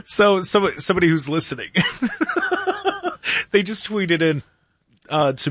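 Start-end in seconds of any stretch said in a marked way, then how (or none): 1.7-3.5 high, sudden laugh at a funny tweet; burst of laughter. Real, not acted. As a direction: break into real laughter. Host reacting to funny tweet.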